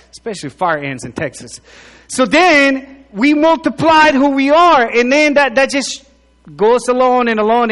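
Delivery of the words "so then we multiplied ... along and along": fervent pulpit delivery climbing higher; preaching at full force. Acted. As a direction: lift the room, preaching hard. Preacher building to a point.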